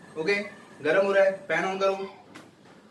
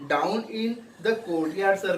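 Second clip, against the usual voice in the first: slow read-aloud; 2 semitones higher, much slower than usual. Shown as a demonstration. Teacher reads steward's reply.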